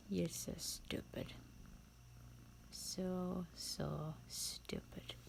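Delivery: hushed, airy, drawn-out scorn; quiet contempt. Acted. Castle villain belittling a foolish intruder, hushed and scornful. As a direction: sink to a slow near-whisper of disdain.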